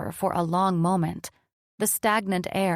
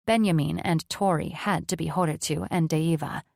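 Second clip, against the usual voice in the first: ordinary delivery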